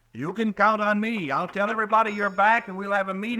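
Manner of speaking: nervous eagerness; eager. Acted. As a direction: storekeeper eager to call a town meeting. Green eager to rally town.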